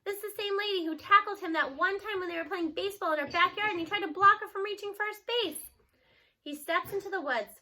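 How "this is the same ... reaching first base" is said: plain reading voice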